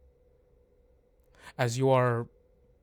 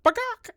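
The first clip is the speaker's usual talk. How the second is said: high, loud silly crow call; a goofy crow caw to set the scene; 11 dB louder than usual. Shown as a demonstration. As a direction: squawk like a distant crow. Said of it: "crows in the distance".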